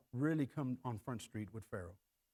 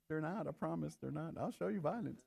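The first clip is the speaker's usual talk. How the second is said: mock menace; 7 dB quieter than usual. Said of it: "I'm like".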